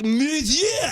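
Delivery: high, straining "Monsieur"; hammed-up attempt to copy the teacher; mock struggling student. Student repeating after his French teacher, exaggerated straining. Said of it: "And then I was like".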